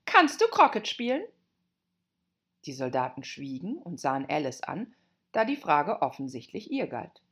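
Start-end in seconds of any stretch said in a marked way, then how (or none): 0.0-1.2 high, loud royal demand; shouted question. Acted. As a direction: call it out as a bossy queen. Queen shouts a question at Alice.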